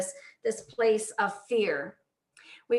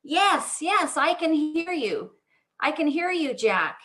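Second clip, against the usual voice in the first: lightly acted call back, raised in pitch; answering voice from above; 6 semitones higher than usual. Unseen voice answering the man on the cliff, calling back. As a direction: answer a distant cry for help. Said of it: "finally he heard someone say".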